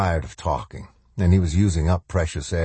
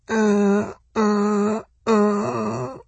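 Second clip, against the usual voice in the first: choking stutter; strained high-pitched choking grunts; high stuttering choking noise; much slower than usual. A narrator's reading. Old man choking in the dark, stuttering noise. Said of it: "making a stuttering, choking noise".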